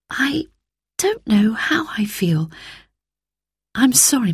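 whispered, sad and unsure; a girl too upset to speak up; hushed. Acted. Troubled girl to mother.

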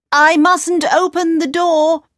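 put-on high, girlish and a little loud; high young girl, anxious.

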